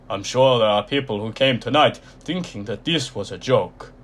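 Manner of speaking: loud, croaky ringmaster announcement; croaky showman voice. A narrator's reading. Mister Tall welcomes the audience to his freak show, loud and croaky. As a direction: proclaim it like an ancient circus master, loud. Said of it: "his voice was low and croaky".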